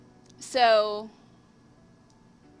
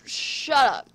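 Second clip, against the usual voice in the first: loud, higher slurred retort; drunken slur; 5 semitones higher, 10 dB louder than usual. Acted. Drunk Robin cutting off her companion, loud slur. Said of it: "Robin slurred".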